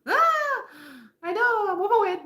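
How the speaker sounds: wife admitting she is overweight, in a high mock voice; imitating the wife. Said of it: "She says".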